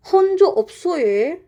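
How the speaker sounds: high, fast Jeju greeting; "혼조 없어요" said quickly and high in Jeju dialect. Shown as a demonstration. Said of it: "first example is like".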